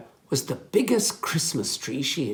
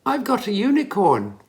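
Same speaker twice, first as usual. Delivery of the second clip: bright, raised and louder; excited announcement; 7 semitones higher, 5 dB louder than usual. A narrator's reading. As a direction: announce it proudly. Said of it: "Millie announced".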